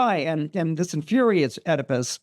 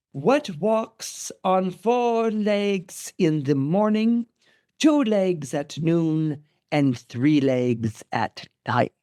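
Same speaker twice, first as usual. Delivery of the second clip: Sphinx intoning; much slower than usual. Retold or imitated. Sphinx posing her deadly riddle to Oedipus, slowly intoning.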